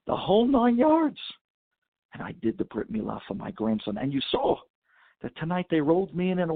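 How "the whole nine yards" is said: pitched way up and loud, triumphant; high, loud burst of pride; excited. Retold or imitated. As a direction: crow it like the proudest grandpa. Grandfather boasting of the full ceremony.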